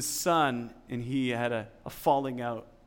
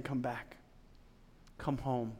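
much softer, gently pleading; soft fatherly call; 3 semitones lower, 11 dB quieter than usual. Acted. The Father calling the listener home, hushed. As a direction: call them home in a hush. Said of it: "this voice of the Father saying".

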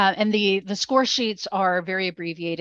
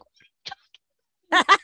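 mock tipsy squeak; high-pitched tipsy voice; 12 semitones higher, 6 dB louder, much faster than usual. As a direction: imitate your own high drunk voice. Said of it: "my voice gets really high pitched".

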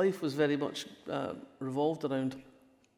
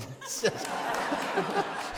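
high-pitched breathy giggle; high laugh. Real, not acted. Laughing at her pizza story.

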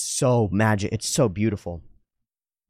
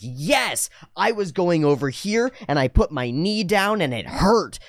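put-on high, emphatic kid pouring it out; upset kid; 7 semitones higher, slower than usual. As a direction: complain like a child finally being heard. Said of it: "And the kid goes".

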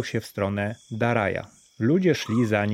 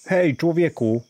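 high, loud hail to a stranger; hey there shout; calling out; 7 semitones higher, 3 dB louder than usual. A narrator's reading. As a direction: call across the street. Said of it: "zawołał Dari".

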